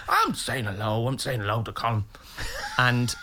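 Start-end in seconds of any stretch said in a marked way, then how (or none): 0.1-2.0 mildly hammed-up stubborn demand; mock insistence. Dad overrules son at green room, stubborn. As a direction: dig in stubbornly, repeating it. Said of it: "your father was insisting on".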